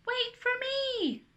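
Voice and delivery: high, drawn-out call; shout. A narrator's reading. Angel to Chocolate, shouting to be waited for. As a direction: call after a friend running off. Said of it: "An angel shouts to Chocolate".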